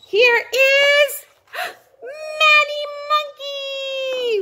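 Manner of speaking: gasping, high, slow announcement of the monkey; big reveal. Acted. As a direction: present him like a showstopper.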